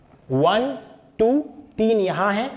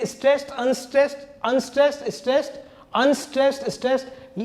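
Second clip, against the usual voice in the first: Unstressed, stressed in higher-pitched, measured alternation; higher, rhythmic listing of unstressed and stressed; rhythm demonstration; 6 semitones higher, faster than usual. Shown as a demonstration. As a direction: recite stressed and unstressed as beats of a verse, pitched up. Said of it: "इस तरह से कविता की लय चल रही है".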